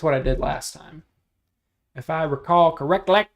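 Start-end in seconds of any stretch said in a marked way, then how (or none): 1.9-3.3 Eastbound and Down quote. Retold or imitated. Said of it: "Anyways, that's from that".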